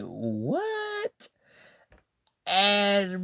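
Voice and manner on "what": shocked what. Retold or imitated. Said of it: "I'm sitting there like".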